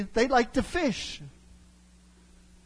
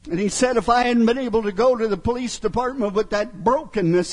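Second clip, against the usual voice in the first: ordinary talk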